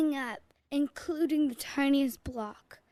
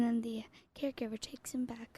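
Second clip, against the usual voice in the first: hushed storytelling whisper; 11 dB quieter than usual. Acted. Telling the daycare story to her co-host in a whisper. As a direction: whisper it like a secret bedtime tale. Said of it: "I start whispering and tell the story".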